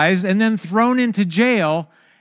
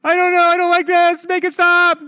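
much higher and louder, a frantic mock cry; panicked scream; 12 semitones higher, 3 dB louder than usual. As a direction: scream it in panic from the jail cell. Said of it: "he screamed".

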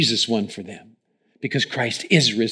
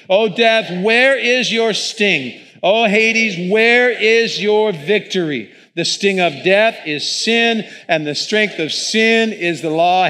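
high, loud defiant proclamation; loudest preacher voice; 6 semitones higher, slower than usual. Real, not acted. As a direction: shout it in defiance of death, fist raised. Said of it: "let's read it out loud and loud".

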